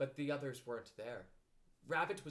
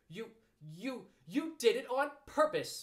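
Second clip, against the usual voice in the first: high, stuttered outburst rising loud; sputter, then accuse loudly; 9 semitones higher, 8 dB louder, slower than usual. Acted. Piglet after a mouthful of soap, sputtering. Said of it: "sputtered Piglet".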